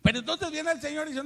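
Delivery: loud and high preacher's build-up; preaching. Acted. Preacher to congregation, loud, high build-up. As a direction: lift it up to the people.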